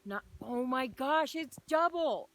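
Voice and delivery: a real rush of excitement at a double rainbow; excited, freaking out. Real, not acted. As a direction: lose your cool as a double rainbow appears. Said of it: "I'm freaking out".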